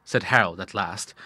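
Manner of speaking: ordinary delivery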